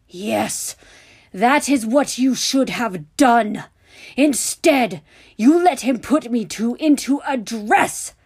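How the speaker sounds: loud, slow, hammered words; furious, emphatic scolding at high volume; angry shouting. A narrator's reading. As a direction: berate him, punching each word. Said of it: "just couldn't stop shouting at him".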